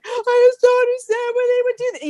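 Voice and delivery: high, wailing mock sob; half-laughing, high teary whine; imitating crying. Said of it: "heavy crying".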